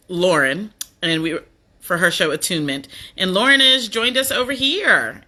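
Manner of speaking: ordinary talk, nothing marked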